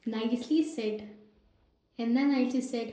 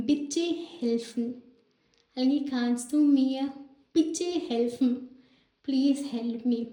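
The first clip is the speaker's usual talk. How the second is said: very slow, word by word; stretched-out classroom phrases; slow teaching demo; much slower than usual. Shown as a demonstration. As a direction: spell the phrases out for learners. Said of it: "teacher demonstrating German phrases".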